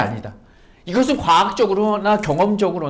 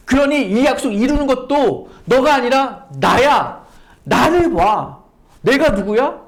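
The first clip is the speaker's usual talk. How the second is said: God speaking; 4 semitones higher, 4 dB louder than usual. Acted. Preacher voicing God telling Sarah to look at him, louder earnest proclamation. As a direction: call her to look at you with authority. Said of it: "라고 말씀하신다는 거예요".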